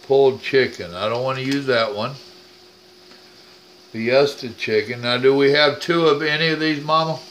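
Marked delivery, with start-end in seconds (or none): 5.0-7.2 raised voice calling to another room. Real, not acted. Husband calling to Mama in the kitchen, raised voice. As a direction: call out so Mama can hear.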